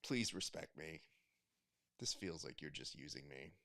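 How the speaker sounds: small, low, hushed voice; quiet, deflated mock hurt; meek mock plea. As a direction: plead softly, as if hurt and used. Imagined hurt competitor pleading.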